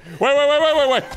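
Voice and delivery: high, rapid-fire panicked repeats. Real, not acted. Gamer who can't swap weapon mid-fight, panicked. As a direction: rattle it off in rising panic.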